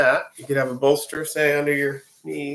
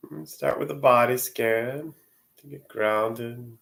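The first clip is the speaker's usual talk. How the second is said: guided meditation; 2 semitones lower, 4 dB quieter than usual. Real, not acted. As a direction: ease them gently into the body scan, unhurried. Leads a Yoga Nidra session.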